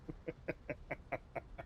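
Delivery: soft, airy run of chuckles; breathy chuckle. Real, not acted. After hamming up croissant, soft airy chuckle. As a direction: chuckle quietly to yourself.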